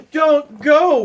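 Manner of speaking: high, loud pleading wail. Acted. Bear wails at the leaving mouse, loud and high. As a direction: cry out so the friend won't leave. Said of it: "wailed Bear".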